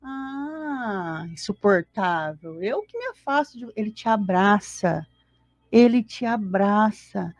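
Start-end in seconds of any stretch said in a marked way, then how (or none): 0.0-3.7 long sighed "Ah", then a drawn-out complaint; mock disdain. Spiritual benefactor supposedly rejecting a flawed person, in mock disdain. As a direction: act out an exasperated rejection, slowly at first. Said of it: "o teu benfeitor não faz assim".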